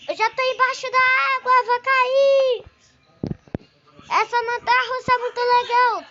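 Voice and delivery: loud, fast excited riding; on a roller coaster. Real, not acted. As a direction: shout with ride thrill.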